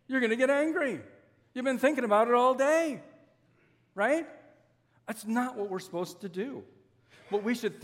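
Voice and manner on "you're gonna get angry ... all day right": raised, higher voice driving the point home; emphatic punchline. Acted. Preacher telling the congregation what really happens, emphatic and raised. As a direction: land it high and loud, pushing for agreement.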